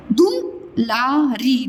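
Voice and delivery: Dulari drawn out; slow. Shown as a demonstration. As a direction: model Dulari slowly. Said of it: "teacher models word slowly".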